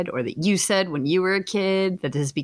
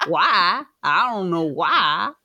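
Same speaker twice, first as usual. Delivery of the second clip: slow mock Southern drawl; Kentucky accent; 4 dB louder, slower than usual. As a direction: draw it out in exaggerated Kentucky. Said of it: "easing into a Kentucky".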